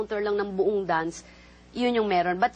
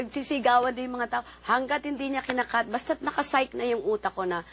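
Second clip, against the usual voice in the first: usual speaking voice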